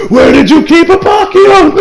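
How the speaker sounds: high, loud, drawn-out angry shout; hammed-up furious demand; mock cursing rage. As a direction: yell it like an enraged anime hero. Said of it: "starts, like, cursing Italian".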